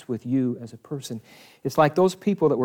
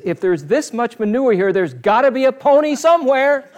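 high and loud, bursting with joy; excited boy; 10 semitones higher, 8 dB louder than usual. Acted. The optimist boy shouting his hope to the grown-up, thrilled. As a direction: deliver the punchline as a thrilled kid. Optimist boy's punchline quote.

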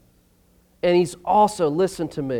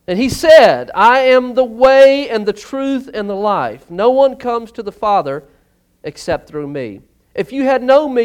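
loud, raised and slowing proclamation; preaching at full force; 3 semitones higher, 6 dB louder than usual. Acted. Preacher declaring Jesus's answer to the church, loud and slow.

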